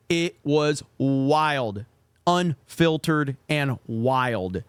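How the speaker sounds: drawn out, each word stretched; slow and savoring. Real, not acted. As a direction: savor every word slowly. Nostalgic gaming lobby memories.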